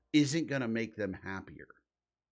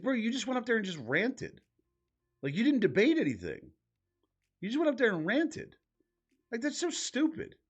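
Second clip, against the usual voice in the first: pitched way up, can't believe what he just saw; incredulous rant; 10 semitones higher than usual. Real, not acted. Streamer venting at the debater in the clip, incredulous high rant. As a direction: voice climbing much higher in exasperated disbelief.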